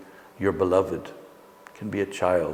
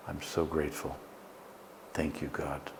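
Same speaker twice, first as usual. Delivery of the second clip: softer murmured thanks to God; quiet inner prayer; 5 semitones lower, 10 dB quieter than usual. Shown as a demonstration. Teacher showing the words she says to herself, hushed and soft. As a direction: breathe the words like a private prayer. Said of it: "I like to say to myself".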